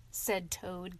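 usual speaking voice

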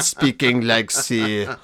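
slow, hammed-up French accent; movie Frenchman accent. Describing the footballer's interview, hammed-up French accent. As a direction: drag the words out in mock French. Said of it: "French guy from a movie".

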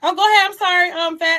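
breaking up audio. Real, not acted. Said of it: "You, you breaking up".

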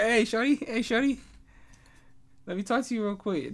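high-pitched mock holler at a girl; imitated shouting. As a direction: call out high, imitating a friend's catcall. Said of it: "he started shouting at the girl".

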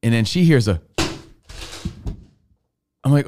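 usual speaking voice

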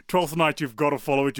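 normal talking voice

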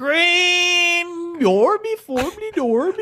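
high, slow mock Swedish voice; mock Swedish accent. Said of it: "did he go".

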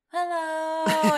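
loud, high, overdone "Hello"; dramatic hello. Retold or imitated. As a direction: call "Hello" across the room. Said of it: "he's like".

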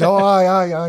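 loud, high burst of eager agreement; mock-flustered yeses; flustered backpedalling. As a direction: scramble to sound respectful after a blunder. Suddenly polite after realising who rang.

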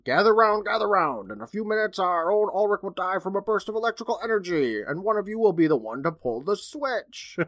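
high, booming sideshow announcer's call; carnival barker. Acted. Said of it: "We cut to the Barker".

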